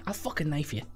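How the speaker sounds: Irish accent. Shown as a demonstration. Player shows his only Irish phrase, Irish accent. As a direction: toss it off quietly in mock Irish. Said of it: "with an Irish accent".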